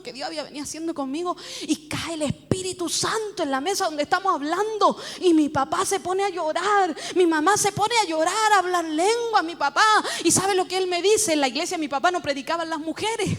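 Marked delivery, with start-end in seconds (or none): none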